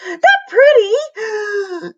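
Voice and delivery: high, slow outraged scream; wailing shriek, pitched high. A narrator's reading. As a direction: scream it in disbelief. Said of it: "screamed Victoria".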